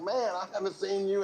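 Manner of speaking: high, bright mock astonishment building up; staged surprise. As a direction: ham up bumping into an old friend. Staged reunion continues.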